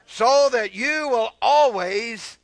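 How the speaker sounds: slow, loud, stretched words; forceful scripture reading. Real, not acted. Preacher reading the promise to the church, slow and forceful. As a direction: draw out the promise with pulpit force. Reads scripture aloud to congregation.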